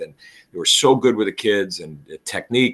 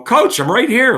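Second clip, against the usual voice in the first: high, eager student voice, louder; a student pipes up to catch coach's attention; kid's voice; 12 semitones higher, 5 dB louder than usual. Retold or imitated. Student tells the coach he's right there, high eager kid voice.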